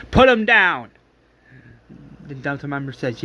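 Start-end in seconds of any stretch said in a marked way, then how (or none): 0.1-0.9 loud, higher commanding shout; barked order. Retold or imitated. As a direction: call out the order to your squad. Delta member orders the others attacked.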